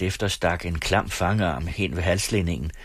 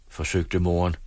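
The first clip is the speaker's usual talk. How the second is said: usual speaking voice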